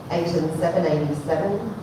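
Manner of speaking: ordinary talk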